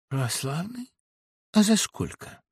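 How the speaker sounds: тихая насмешливая переспрашивающая интонация; уколи издевательским вопросом; насмешка. Acted. Сашка насмешливо отвечает. (тихая ирония)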